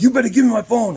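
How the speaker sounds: high-pitched angry warning; imitating a heated customer; retold threat, pitched well up; threatening demand. Customer threatens over his phone.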